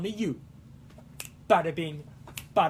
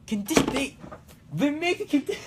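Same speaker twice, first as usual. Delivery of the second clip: put-on light Italian accent, pitched up; a breezy stab at an Italian accent; lightly put-on Italian accent; 8 semitones higher than usual. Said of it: "I want to do the accent".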